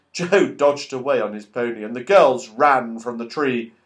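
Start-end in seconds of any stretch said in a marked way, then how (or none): none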